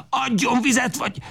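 very high desperate demand; frantic demand. A narrator's reading. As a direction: shout it beside himself. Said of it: "kiáltja magán kívül". (magán kívül követelve)

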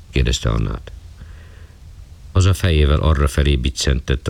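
ordinary delivery

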